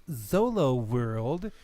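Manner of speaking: ordinary delivery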